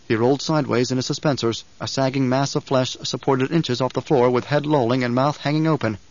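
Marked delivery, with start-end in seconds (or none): none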